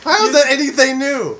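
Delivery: laughing. Real, not acted. Laughing off being called drunk.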